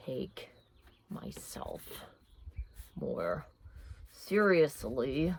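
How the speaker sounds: hushed, slow, deliberately hammed-up sincerity; mock solemn journaling. Vlogger pretending to journal a resolution, hammed-up hush. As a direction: pretend to write a grave resolution, badly acted. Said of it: "Bad acting".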